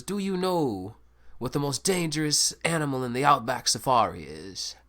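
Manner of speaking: a jokey Australian presenter teasing the crowd; Australian accent. Retold or imitated. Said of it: "So I did it in an Australian accent".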